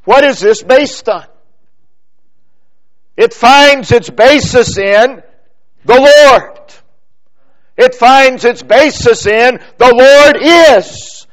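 high, loud pulpit proclamation; full-force preaching. Acted. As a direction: proclaim it with certainty to the congregation.

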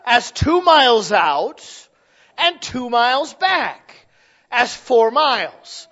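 slow, loud, drawn-out numbers; preacher spelling out the miles word by word; slow emphatic counting. Acted. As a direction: lay each number out slowly for the crowd. Spelling out the math slowly.

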